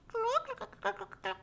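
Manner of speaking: high, quiet chicken clucks; a quick run of hen clucks; clucking chicken. Acted. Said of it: "Chickens clucked".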